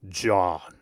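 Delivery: low, imitated voice of God; deep bass voice. Prankster calls John through the radio, deep bass voice. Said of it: "his deep bass voice into the microphone".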